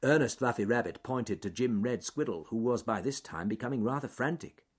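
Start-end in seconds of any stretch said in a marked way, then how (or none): none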